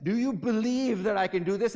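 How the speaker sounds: rally a huge crowd with it; enacted Great Blondin. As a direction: daredevil shouting out to the crowd. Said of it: "really get into this situation".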